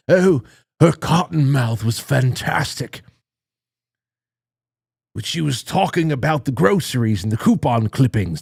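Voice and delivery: hammed-up British accent, savoring every word. Raving to listeners about an old woman's cotton mouth, mock British. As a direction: put on a relishing British voice. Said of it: "I don't know why I turned in British".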